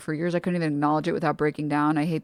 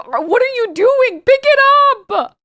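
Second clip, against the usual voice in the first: shrill screaming mom, much higher and louder, slow; angry mom; 8 dB louder, slower than usual. Retold or imitated. Mom screaming at her child who dropped the milk, much higher and louder. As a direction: yell like a furious mother over spilled milk. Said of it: "my mom would ... be like".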